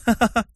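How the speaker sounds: put-on high, short girlish ha-ha-ha; mocking laugh. A narrator's reading. Girl taunting her friend, high mocking laugh.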